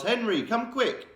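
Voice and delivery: mother yelling for her husband; high, drawn-out call for help. Acted. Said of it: "And she yells".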